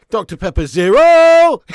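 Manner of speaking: loud, high, incredulous yell; outraged shout. Real, not acted. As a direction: stretch the last word into a shouted protest. Mock outrage at the product name.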